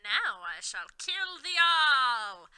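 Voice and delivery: slow, loud, menacing villain boom; evil villain threat. A narrator's reading. Voldemort threatening everyone, slow loud menace. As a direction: drag it out like a gloating dark lord. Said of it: "he cackled evilly and sarcastically".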